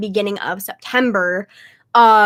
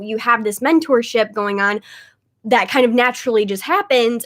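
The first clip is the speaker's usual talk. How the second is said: usual speaking voice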